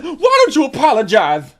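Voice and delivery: imitating her huffy rant; mock indignant. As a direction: play the offended girl, loud. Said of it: "And she said".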